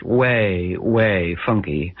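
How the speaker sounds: drawn-out emphasis. A narrator's reading. Describing Dame Ragnall's smell.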